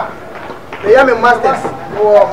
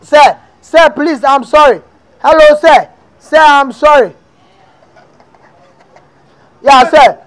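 pleading apology; 6 semitones higher, 4 dB louder, slower than usual. Acted. Caller begging his lecturer.

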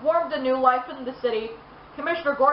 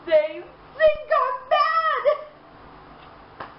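much higher, very slow, whiny sob; wailing Joker; 13 semitones higher, much slower than usual. A narrator's reading. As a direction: drag it out like a crazed crybaby. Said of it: "Joker sobbed".

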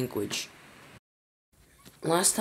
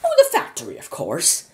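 much higher, chirpy squeak; squeaky Wonka; 4 semitones higher, 5 dB louder than usual. A narrator's reading. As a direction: toss it off with a squeak. Wonka's breezy answer.

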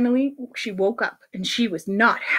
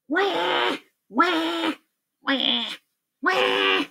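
higher, short mock wails; baby's cry; 6 semitones higher, 4 dB quieter, much slower than usual. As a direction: cry like a little baby. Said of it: "the baby said".

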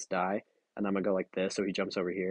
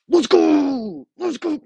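victory cheer; 9 dB louder, much slower than usual. Real, not acted. Winning the match, cheering.

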